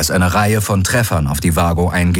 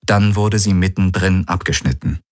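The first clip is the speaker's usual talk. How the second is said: usual speaking voice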